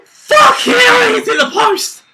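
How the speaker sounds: high, loud scream of relief. Real, not acted. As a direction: scream as the shot hits post. Shot hits the post.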